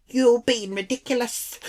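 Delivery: high-pitched failed attempt at Irish; badly faked Irish indignation; bad Irish accent. Irish lady scolding her, bad Irish accent. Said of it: "Can't do the accent".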